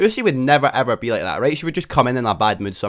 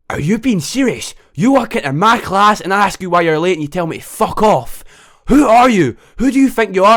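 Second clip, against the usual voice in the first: high, loud outraged telling-off; the teacher finally loses it at Lucy; angry teacher; 7 semitones higher, 5 dB louder than usual. Retold or imitated. Teacher snaps at late Lucy.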